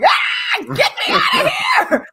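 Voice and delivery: very high-pitched mock screech; hammed-up child's scream, squeezed way up high; imitated scream. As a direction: screech like a panicking kid. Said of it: "this kid is screaming like".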